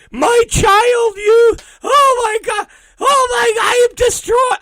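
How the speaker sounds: loud, high hammed-up bawling; mock wailing. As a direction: wail like a melodramatic devastated fan. Mocking fans grieving a celebrity.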